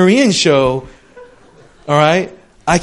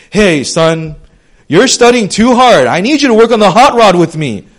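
hammed-up loud dad, higher and louder; imagined dad; 4 semitones higher, 8 dB louder than usual. Pastor imagining a Korean dad calling his son, hammed-up and loud. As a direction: belt it like a goofy sitcom father. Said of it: "can you imagine a Korean dad being like".

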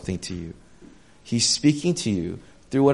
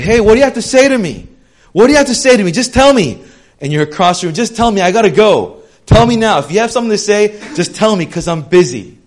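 much louder and higher, rushed and impatient; impatient shouting; 9 semitones higher, 11 dB louder, faster than usual. Retold or imitated. Busy person shouting across the room, demanding you speak now. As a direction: yell it across the room at someone who's too busy. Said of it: "they're like".

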